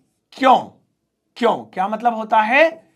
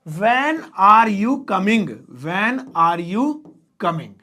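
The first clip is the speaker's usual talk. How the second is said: slow dictation; much slower than usual. Shown as a demonstration. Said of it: "dictating slowly while writing".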